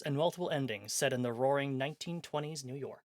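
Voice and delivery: ordinary talk, nothing marked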